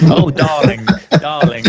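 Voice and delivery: loud, hammed-up British accent; mock British accent. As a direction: play up a theatrical British accent, loudly. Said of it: "I can't even do a British accent".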